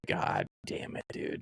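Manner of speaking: low, hushed exasperated curse; muttered, resigned disbelief; retold dismay. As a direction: drop right down, nearly voiceless. Retold dismay at prank pizza.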